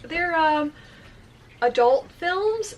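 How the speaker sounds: retold awkward panic, pitch climbing; hesitate, then squeak out the embarrassing answer; panicked. Clerk explains adult films to a couple, panicked. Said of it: "and then I'm panicked".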